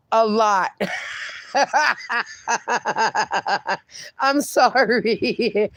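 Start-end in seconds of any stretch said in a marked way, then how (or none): 0.8-2.2 soft burst of giggling that trails on; real laughing. Real, not acted. Laughs at viewer's answer. 4.2-5.7 slow apology breaking into laughter; laughing apology. Real, not acted. Apologizes while still laughing.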